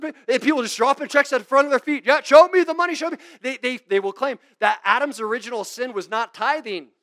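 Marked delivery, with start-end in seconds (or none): 2.1-3.2 loud, high hammed-up preacher calling for money; mock offering call. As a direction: bark out the amounts like an auctioneer preacher. Preacher mocked demanding money.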